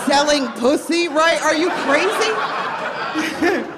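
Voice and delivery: loud, high, laughing disbelief; mock outraged shout. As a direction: yell it in horrified laughter. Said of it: "I was like".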